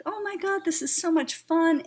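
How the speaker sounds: high, bright burst of delight at the market; delighted exclaim. Retold or imitated. Telling how the farmers market felt, loud and high with excitement. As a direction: squeal it out with sudden joy. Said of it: "I was like".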